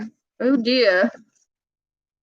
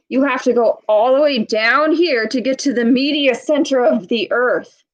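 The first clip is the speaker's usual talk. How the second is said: ordinary delivery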